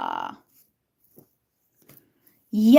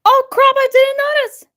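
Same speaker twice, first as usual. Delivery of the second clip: high, loud panicked gasp of realisation; panicked realization; 8 semitones higher, 4 dB louder than usual. Real, not acted. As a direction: blurt it out, caught off guard. Realises she missed a viewer.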